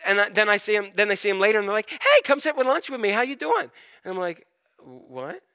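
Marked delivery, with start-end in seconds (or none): 1.9-3.7 friendly greeting. Acted. Friend greeting him later, acted. 4.8-5.4 low, very soft questioning what; small, quiet double take; baffled reaction. Acted. His baffled reaction, acted.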